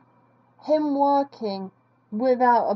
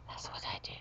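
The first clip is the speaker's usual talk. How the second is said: mock-sinister whisper; 19 dB quieter, much faster than usual. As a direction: drop to a hushed whisper. Mock-villain boast dropped to whisper.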